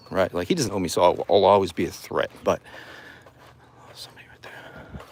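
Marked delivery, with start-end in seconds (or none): 3.9-5.0 whisper. Real, not acted. Hushed aside about the stranger.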